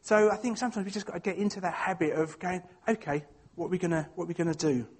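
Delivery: normal talking voice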